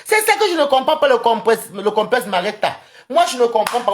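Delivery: high, loud exclaiming; worked-up rant. Real, not acted. Rants about others' complex.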